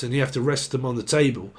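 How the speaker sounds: ordinary talk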